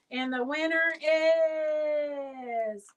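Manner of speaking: slow, drawn-out winner reveal; drawn-out announcer voice. Pretend. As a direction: build suspense like a game show host.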